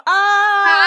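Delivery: excited muffled squeal behind a hand; hand over mouth. Retold or imitated. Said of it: "I put my hand over my mouth and I just go".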